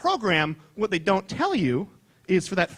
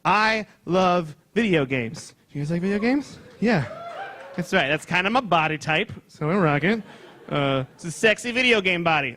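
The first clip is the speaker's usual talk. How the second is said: ordinary talk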